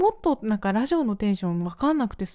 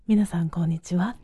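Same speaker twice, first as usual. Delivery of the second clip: airy whispered hostess greeting; breathy radio voice; 3 semitones lower than usual. Shown as a demonstration. Demoing a midday radio hostess, whispery and breathy. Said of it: "ちょっと息多い系の声".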